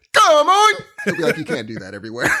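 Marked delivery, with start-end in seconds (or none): 0.1-0.7 loud, high mock-British outburst; over-the-top accent-mocking holler; mock British shout. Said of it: "I hear the accent, I'll be like". 1.4-2.3 low, soft telling-off; hushed, pitched-down scolding; calm reprimand. Acted. British host quietly scolds the shouter.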